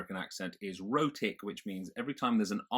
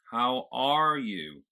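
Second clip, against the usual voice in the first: slow, louder General American "How are you?"; American accent; 3 semitones higher, 5 dB louder, much slower than usual. Shown as a demonstration. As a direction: stretch ARE with an American r, open at the back. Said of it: "I've got this sort of opening right at the back".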